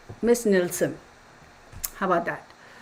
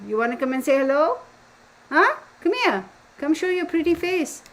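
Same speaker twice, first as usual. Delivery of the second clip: high coaxing voice for a dog; pet talk; 8 semitones higher, 3 dB louder than usual. Real, not acted. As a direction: sweet-talk a sleepy pup in a raised pitch. Coaxing her sleepy dog over.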